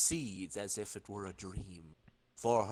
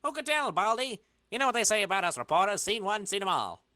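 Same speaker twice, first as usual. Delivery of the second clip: lightly higher, fast wisecracking reporter; higher, fast reporter patter; 10 semitones higher, 4 dB louder, faster than usual. Acted. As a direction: quip like a brash newsman. Reporter character voice.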